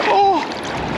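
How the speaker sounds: a sudden high yelp of surprise; startled oh. Real, not acted. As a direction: jolt at the cold splash. Icy water splashes his jeans.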